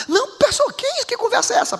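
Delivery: pitched high in offended disbelief; indignant surprise. Acted. Husband protesting to the pastor, high and indignant. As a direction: play the man's shocked protest, way up high.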